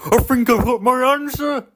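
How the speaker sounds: excited toy car crowing his answer; Car's shout. Acted. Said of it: "shouted Car".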